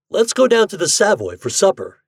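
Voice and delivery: mildly voiced cheerful suggestion; Ford voice. A narrator's reading. Ford inviting brightly.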